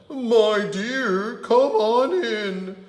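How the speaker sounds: high, loud fake granny voice; wolf squeaking a sweet granny. Wolf pretending to be Grandma, calling Red in. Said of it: "He called out".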